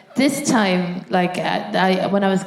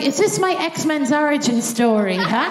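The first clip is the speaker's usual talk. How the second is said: mock heroic wonder; 7 semitones higher than usual. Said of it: "I was like".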